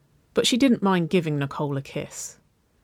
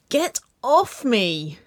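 high, drawn-out protesting wail; a child's long whiny cry to be let go; 7 semitones higher, 4 dB louder, much slower than usual. A narrator's reading. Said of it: "she wailed".

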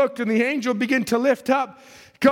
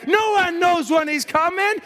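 ordinary talk

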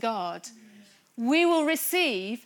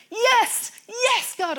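imitating a worshipper's high, loud shouts; Dave; 11 semitones higher, 10 dB louder, much slower than usual. Preacher imitating a friend getting excited in worship, shouting. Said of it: "what Dave is shouting".

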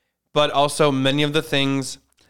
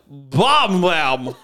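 mock Fox newscaster, louder and punchy; newscaster impression; 4 dB louder than usual. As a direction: intone it like a TV anchor. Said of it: "She goes like".

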